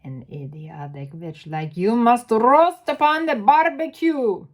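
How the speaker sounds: put-on loud gloating witch accent; Grand High Witch accent. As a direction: pronounce the sentence with cruel relish. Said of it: "do the accent".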